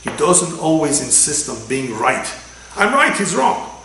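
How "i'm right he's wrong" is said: higher, louder mock of a know-it-all; mock stubborn insistence. Rabbi mimicking a stubborn person, higher and louder. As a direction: mimic someone who must be right. Mocking someone who insists on being right.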